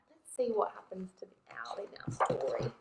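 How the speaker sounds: quick hushed whisper. Real, not acted. Parent whispering to Emma before the story starts. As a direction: whisper it like a secret. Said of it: "whispered aside to child".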